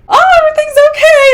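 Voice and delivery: high, quick, breezy relief; mock cheery survivors. Survivors stepping outside, high and breezily relieved. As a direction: chirp it like nothing happened.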